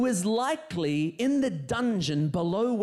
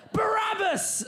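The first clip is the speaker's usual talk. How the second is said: crowd shout; 13 semitones higher than usual. Acted. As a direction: bellow the name like an angry mob. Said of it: "the crowd, uh, shouted".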